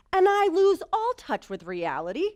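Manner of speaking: rising to a half-scream as she relives it; retold panic. To the audience, reliving the runaway horse, loud and panicked. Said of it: "like, screaming".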